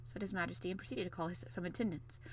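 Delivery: plain reading voice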